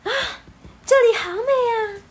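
high, loud breathless wonder; overwhelmed by beauty; awestruck gasp. Acted. Amazed at the magic forest. (惊叹不已)

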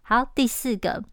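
ordinary talk

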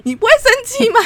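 high, loud indignant question. Real, not acted. Venting to the co-host about the meeting, indignant. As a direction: demand agreement, outraged.

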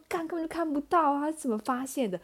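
can't believe he saw; incredulous. Retold or imitated. Indignant inner voice.

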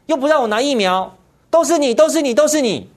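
jab it out like a sulky accuser; mocking the party as it blames the mainland; mock blaming whine. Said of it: "整天骂中国大陆".

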